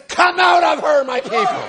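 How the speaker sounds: high, loud prophetic shout; shouted summons, easing on the last words; commanding changed voice. Retold or imitated. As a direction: cry it out as a command from the pulpit. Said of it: "With that came a change in voice".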